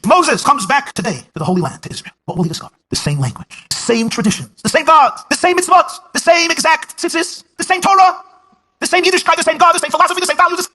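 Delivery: rising, high, loud sermon crescendo; building preaching. Acted. Preacher lists what Moses finds in Israel, rising fervour.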